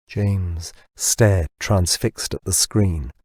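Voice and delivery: ordinary delivery, nothing marked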